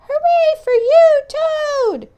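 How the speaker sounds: very high, loud cheer; high shouted hooray. Acted. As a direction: cheer from the audience. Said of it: "as he shouted".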